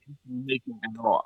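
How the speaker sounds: dismissive quick mumble. Retold or imitated. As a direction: brush him off quietly. Gate agent brushing him off.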